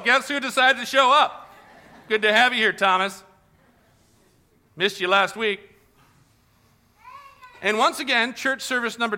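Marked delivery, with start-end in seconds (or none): 0.0-5.7 high, loud cheery roll call; John taking attendance. Retold or imitated. John calls roll, teasing late Thomas, cheerfully. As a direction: greet Thomas with a teasing laugh. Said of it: "He's like".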